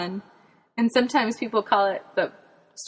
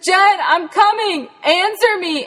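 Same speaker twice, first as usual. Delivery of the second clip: high, loud calling into the brush; 11 semitones higher, 7 dB louder, slower than usual. Retold or imitated. Dad searching the woods for Jen, calling out in a high loud shout. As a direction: shout as if hunting for a lost child.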